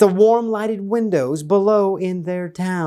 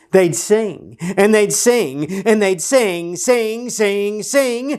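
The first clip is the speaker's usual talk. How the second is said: each sing climbing higher; singsong storyteller climbing on sing; rising repetition; 4 semitones higher, slower than usual. A narrator's reading. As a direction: let sing lift up the scale.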